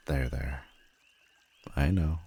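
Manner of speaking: soothing. Acted. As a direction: hush him like a child. Comforting a crying hero.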